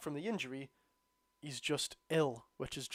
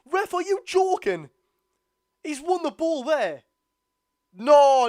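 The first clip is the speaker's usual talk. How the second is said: much higher and louder, slow furious disbelief; outraged yelling; 11 semitones higher, 12 dB louder, slower than usual. Real, not acted. Fan screaming at the referee over a tackle, furious. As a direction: yell at the ref in real outrage.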